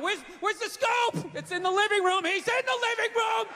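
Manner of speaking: high, frantic mock panic; acted alarm, rising to a high shout; panicked calling out. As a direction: yell across the house in a hammed-up panic. Acted panic during a break-in.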